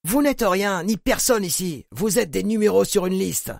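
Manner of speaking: high, cold contemptuous address to the room; guard addressing prisoners. A narrator's reading. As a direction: lecture the prisoners like worthless numbers. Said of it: "pour apostropher l'assemblée".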